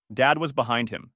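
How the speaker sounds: usual speaking voice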